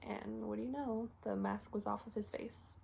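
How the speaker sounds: hushed, halting account of a death; quiet, reluctant confession; subdued grief. Acted. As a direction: speak softly, with long heavy pauses. Acted recollection of finding patient dead.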